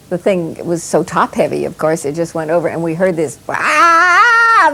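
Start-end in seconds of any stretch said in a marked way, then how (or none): 3.5-4.7 high piercing mock scream; retold horrible scream. Retelling how Bob screamed when he fell: piercing scream. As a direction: shriek like a man falling over. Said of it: "this horrible scream from him".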